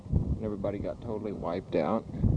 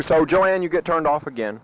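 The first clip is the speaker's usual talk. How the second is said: teasing call; 4 semitones higher, 8 dB louder than usual. Real, not acted.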